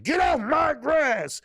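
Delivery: put-on grumpy old man. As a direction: yell it like a cranky old man chasing kids off his lawn. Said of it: "a grumpy old man".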